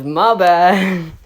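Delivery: loud retold call-out; casual mock yell across to someone; yelled apology. Student yelling to the cops, loud casual apology. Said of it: "he just yells at the cops".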